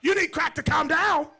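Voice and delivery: loud, high, outraged repeat; husband echoing his wife in disbelief, shouting; incredulous shout. Retold or imitated. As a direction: fling her words back at her. Husband repeats her words outraged.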